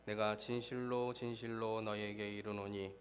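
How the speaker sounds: ordinary talk, nothing marked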